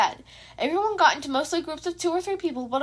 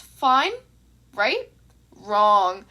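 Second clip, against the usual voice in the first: mock reassurance then flat no; 4 semitones lower, 5 dB louder, much slower than usual. A narrator's reading. Narrator's mock question and answer.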